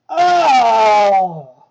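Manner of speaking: loud pained groan; disgusted cry. Real, not acted. Reacting to the fanfic.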